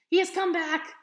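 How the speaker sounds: high, loud, shaky terrified gasp of a word; a panicked old lady crying out from the floor; frightened old woman. Acted. Old librarian frightened by bear.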